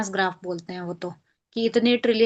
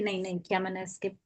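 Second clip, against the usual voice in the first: mumbled customer reply; 4 semitones lower, 11 dB quieter than usual. Retold or imitated. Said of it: "तो बोलेगा कि".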